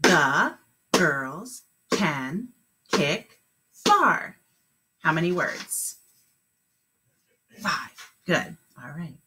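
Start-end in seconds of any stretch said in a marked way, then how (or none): 0.0-4.4 very slow, word by word; clapped syllables. Shown as a demonstration. Teacher clapping words with kindergartners, slow and spaced. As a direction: clap out each word slowly. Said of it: "Let's clap it".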